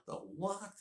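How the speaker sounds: lightly voiced puzzled young man; mock baffled. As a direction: sound thrown by the odd word. Baffled by a strange word.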